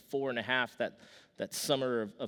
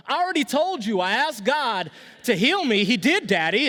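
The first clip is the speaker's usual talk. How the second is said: high, loud imitation of an exasperated little kid; insistent shouting child; 10 semitones higher, 10 dB louder than usual. Son calling from the back seat to dad on the phone, mock-exasperated shout. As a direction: holler it like a small boy insisting.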